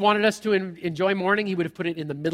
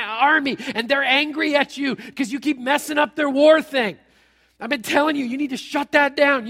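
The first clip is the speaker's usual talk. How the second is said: higher and louder, scolding in disbelief; exasperated servant rant; 5 semitones higher, 6 dB louder than usual. Retold or imitated. Servant scolding Elisha in front of the army, higher and louder. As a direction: tell the prophet off, fed up.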